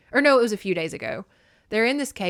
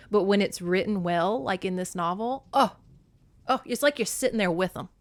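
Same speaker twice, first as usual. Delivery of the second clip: normal talking voice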